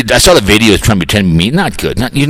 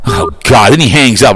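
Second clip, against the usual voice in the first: usual speaking voice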